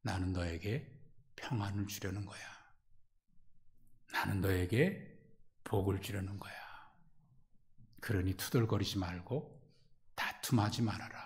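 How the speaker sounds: God quietly comforting the complaining faithful; soft reassurance. Acted. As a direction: speak God's promise gently and low. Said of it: "하나님은 말씀하십니다".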